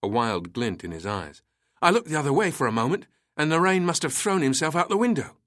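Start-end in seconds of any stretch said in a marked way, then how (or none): 1.8-5.4 fast, high, loud panic; guard excusing himself in a panic; frantic shout. A narrator's reading. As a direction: rush it out in a wild panic. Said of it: "Turner shouted".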